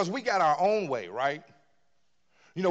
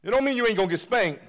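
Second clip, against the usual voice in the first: fast, higher and louder punchline; preaching loud; 6 semitones higher, 7 dB louder, faster than usual. Acted. Preacher warning the congregation about God's spanking, loud and fast. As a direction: hit it hard and quick.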